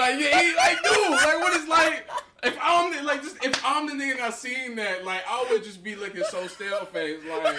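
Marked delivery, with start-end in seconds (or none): 0.0-4.3 high, loud and laughing; bursting out while watching; loud laughing excitement at the clip; excited. Real, not acted.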